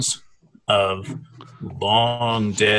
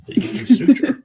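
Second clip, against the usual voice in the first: squeaky laugh; 12 semitones higher, 5 dB louder, faster than usual. Acted. GM mock-squeals the suture word.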